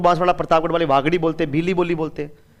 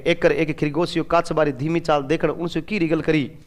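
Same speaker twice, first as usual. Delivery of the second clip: a soft, plain read of a Rajasthani sentence; 5 semitones lower, 6 dB quieter than usual. Shown as a demonstration. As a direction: read the line in everyday Rajasthani. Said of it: "जैसे राजस्थानी में अपन पढ़ते हैं". (राजस्थानी में धीरे से पढ़ना)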